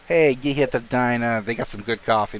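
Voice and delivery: ordinary delivery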